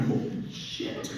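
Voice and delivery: whispered, slowly, in shock; hushed disbelief through a laugh; stunned whisper. Retold or imitated. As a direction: breathe it out, aghast. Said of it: "We're still at the monitor going".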